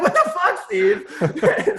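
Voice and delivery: high-pitched, laughing mock outrage; laughing disbelief. Storyteller to his lawyer Steve, in laughing disbelief. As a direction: exclaim high and loud through a laugh. Reacts to lawyer's remark, amused.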